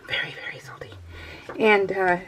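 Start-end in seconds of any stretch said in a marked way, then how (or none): 0.1-0.9 whispered, no voice at all. Shown as a demonstration. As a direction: whisper it like a secret.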